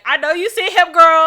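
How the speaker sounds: high, loud, teasing squeal; voicing her body as a pushy friend. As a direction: egg her on gleefully. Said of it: "she'd be like".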